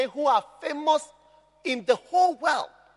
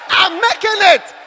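loud triumphant declaration; loud confident affirmation; shouted declaration; 6 semitones higher, 9 dB louder than usual. Shown as a demonstration. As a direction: declare it boldly. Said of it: "begin to say".

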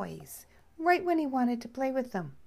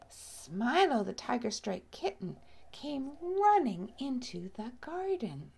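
ordinary delivery

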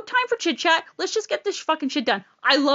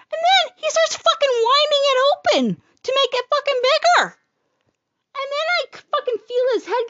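outraged retelling; 12 semitones higher, 3 dB louder than usual. Real, not acted. Telling of painful exam.

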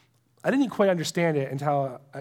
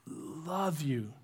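soft, low and hushed; tender love; 3 semitones lower, 9 dB quieter than usual. Acted. Preacher voices God looking at his child, tender and soft. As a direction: voice God's love as a gentle murmur. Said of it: "he's just like".

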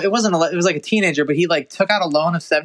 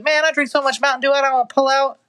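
high-pitched mock teenage dude; high, hammed-up high schooler; 8 semitones higher, faster than usual. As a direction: imitate a dude bragging in a raised pitch. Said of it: "dudes in high school used to be saying".

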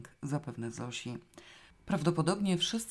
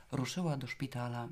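usual speaking voice

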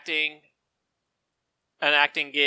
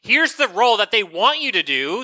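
exasperated mock agent; 5 semitones higher, 7 dB louder than usual. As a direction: spell it out, exasperated, in a raised voice. Retold agent pitch, exasperated argument.

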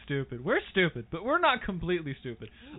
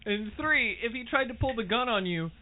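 ordinary talk

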